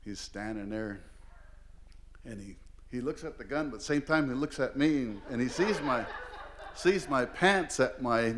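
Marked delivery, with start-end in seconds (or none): none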